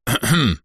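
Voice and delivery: short preparatory cough; throat clearing. A narrator's reading. Hero about to invent a tale, clearing his throat. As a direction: clear your throat before improvising. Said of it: "откашлялся я".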